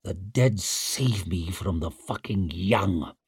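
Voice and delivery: slow, low, weary disgust. Acted. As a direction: grumble it in contempt. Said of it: "disgusted sigh".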